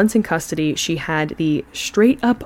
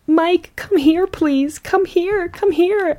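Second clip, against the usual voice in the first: high, imploring imitation of her; mock tearful pleading; 11 semitones higher, slower than usual. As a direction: plead as if through tears, hammed up. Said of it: "through her tears".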